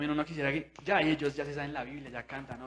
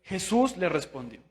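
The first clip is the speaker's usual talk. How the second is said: usual speaking voice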